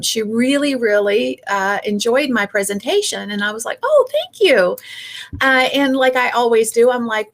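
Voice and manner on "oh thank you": high, bright, louder gushing thank you. Retold or imitated. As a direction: overflow with delight at the compliment. Flattered by audience member's praise.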